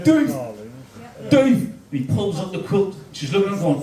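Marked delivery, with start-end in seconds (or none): 0.0-1.6 alarmed calling out. Retold or imitated. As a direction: shout for him in a sudden panic. Wife calling out to husband.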